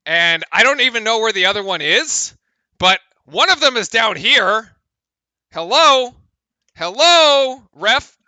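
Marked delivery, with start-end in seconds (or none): 5.5-8.2 loud, high hello to a ref; calling the ref. Real, not acted. Streamer calling out the absent referee, loud. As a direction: yell for the ref's attention.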